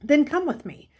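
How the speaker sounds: put-on teacher ordering the boy to follow, firmly; stern command. A narrator's reading.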